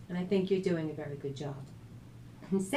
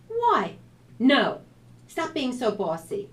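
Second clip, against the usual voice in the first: annoyed; 6 semitones higher, 9 dB louder, slower than usual. A narrator's reading. Sisters arguing.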